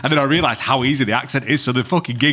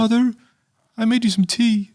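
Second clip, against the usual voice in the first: very high, effeminate put-on voice; Ed Gein; 12 semitones higher than usual. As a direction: do a creepy high-pitched mother's-boy voice. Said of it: "the accent's actually quite easy to do".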